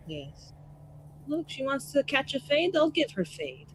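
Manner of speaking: ordinary talk, nothing marked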